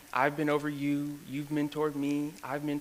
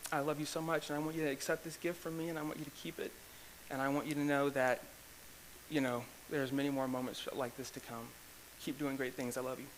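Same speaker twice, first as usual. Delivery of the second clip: much softer, heartfelt and sincere; tender; 9 dB quieter than usual. Real, not acted. Telling his mentor he loves him, softly and sincerely. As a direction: speak it gently, from the heart.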